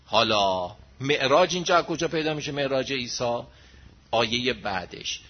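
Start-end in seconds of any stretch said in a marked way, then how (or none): none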